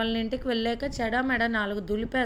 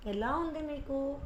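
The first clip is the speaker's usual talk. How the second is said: softer, higher voice for a son; soft concern; 4 semitones higher, 7 dB quieter than usual. A narrator's reading. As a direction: ask your father softly, pitched up. Son gently asks injured father. (మృదువుగా, కాస్త హెచ్చు స్వరంలో)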